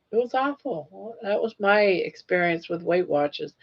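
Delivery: ordinary delivery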